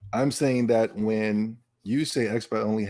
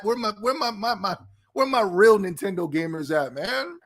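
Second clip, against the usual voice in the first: hype call; 7 semitones higher than usual. Real, not acted. Hyping up the chat.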